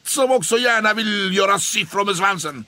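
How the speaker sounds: high, loud mock announcer; loud high taunting call; loud scheming bully. A narrator's reading. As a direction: shove the victim into the spotlight. Måns pushes Pelle on stage.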